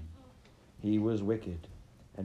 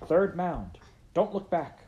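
louder, higher commanding call; authoritative command; 9 semitones higher, 6 dB louder than usual. Acted. Rabbit commanding her friend to flee without looking back. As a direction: order him to run with full authority. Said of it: "with all the authority and confidence".

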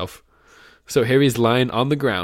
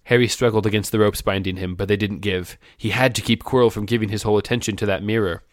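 plain reading voice

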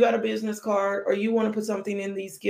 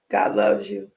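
deep voice; 12 semitones lower than usual. Acted. Repeated God loves you line.

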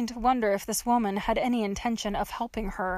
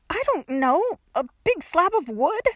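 put-on higher young girl's retort; exasperated; 7 semitones higher, 4 dB louder than usual. A narrator's reading. Trapped girl answers the gravedigger, high and exasperated. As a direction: argue back, exasperated.

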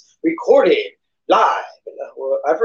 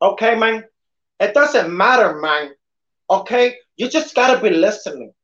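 lightly done Tony Montana tough guy; a hammed-up Scarface impression; 3 semitones higher than usual. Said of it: "my Scarface voice".

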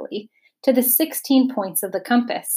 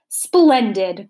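a louder, delighted cry; cried out; 6 dB louder than usual. Acted. The dodecahedron, delighted by Milo's answer, cries out. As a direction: praise the answer with a burst. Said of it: "cried the dodecahedron".

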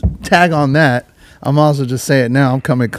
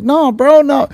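pleading denial; 12 semitones higher, slower than usual. Retold or imitated. Mario pleading.

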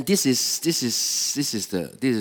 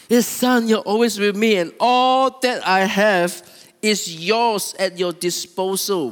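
high, loud, emphatic insistence; shocked father; 8 semitones higher, 7 dB louder than usual. Acted. Shocked father assuring his son all is his, loudly insistent. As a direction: press it on him slowly in a raised voice. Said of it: "And the father was shocked".

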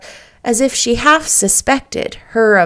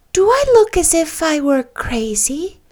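mock solemn; 7 semitones higher than usual. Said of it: "said Anne solemnly".

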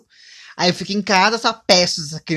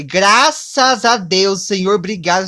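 mock-grateful cry, louder and drawn out; hammed-up thanks; 3 semitones higher, 7 dB louder, slower than usual. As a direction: praise heaven, slowly and loud. Mock relief at sister's news. (agradecimento exagerado, lento e alto)

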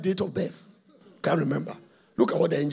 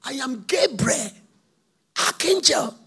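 angry angel; 5 semitones higher, 6 dB louder than usual. Acted. Gabriel snapping at Zachariah, high loud stern. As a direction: cut him off and assert rank. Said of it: "introduced himself and said".

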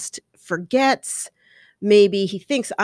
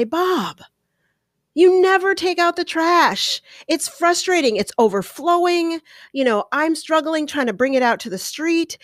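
naggy complaining; 9 semitones higher than usual. Shown as a demonstration. Wife scolding husband Bob over the trash, high naggy whine. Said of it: "comes across as sort of naggy".

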